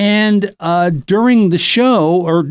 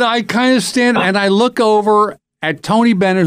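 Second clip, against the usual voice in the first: ordinary talk, nothing marked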